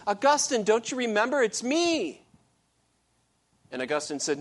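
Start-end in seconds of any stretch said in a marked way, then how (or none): 0.1-2.3 high, loud call after him. Retold or imitated. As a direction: call after him, sure he forgot you. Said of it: "she called out to him".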